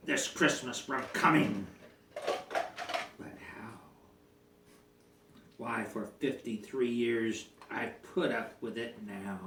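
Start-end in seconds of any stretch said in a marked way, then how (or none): 2.1-9.4 hushed, brooding grumble; grumbling grinch. Acted. Grinch brooding alone over the Whos, hushed. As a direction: mutter it darkly to yourself.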